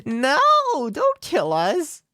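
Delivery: high, slow frightened plea; terrified pleading. Acted. Captive girl begging demons to spare her, high and slow. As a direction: beg for your life, dragging the words. Demon threatens to eat them.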